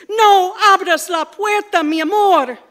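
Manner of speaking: desperate loud cry; screaming. Acted. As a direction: scream it out in panic. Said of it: "his daddy screaming to his mommy".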